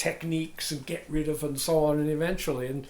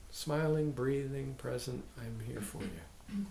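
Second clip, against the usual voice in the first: soft, slowing, lower toward the end; gentle reassurance; 3 semitones lower, 5 dB quieter than usual. Shown as a demonstration. Teacher models a calming phrase for students, gentle and quiet. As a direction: settle quietly into tender presence.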